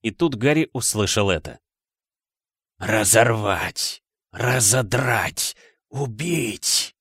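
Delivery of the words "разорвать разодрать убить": very slow, drawn-out menacing creature; icy murderous voice. A narrator's reading. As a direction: draw each word out coldly, ready to kill. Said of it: "ледяной убийственный голос". (ледяной, убийственный голос)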